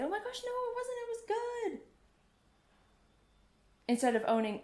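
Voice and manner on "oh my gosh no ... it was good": much higher, sing-song mock reassurance; gushing reassurance. Friends reassuring the performer, high gushing mock voice. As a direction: squeal it like a friend fishing you a compliment. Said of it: "You want other people to say".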